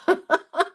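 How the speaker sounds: short high burst of laughter, falling. Real, not acted. Showing viewers how full her book will be, high laugh. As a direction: laugh at the thought of a bulging book.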